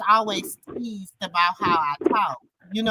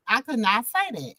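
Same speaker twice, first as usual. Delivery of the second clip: ordinary talk, nothing marked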